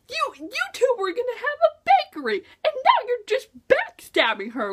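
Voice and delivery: mock-outraged, rattling it off at the traitor; indignant outburst. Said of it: "and I was just like".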